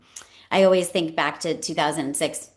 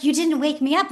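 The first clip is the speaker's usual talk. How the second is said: put-on higher, accusing child's whine; hurt little daughter; 9 semitones higher than usual. Daughter accusing mother, high and whiny. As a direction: whine it like a hurt six-year-old. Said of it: "but she was like".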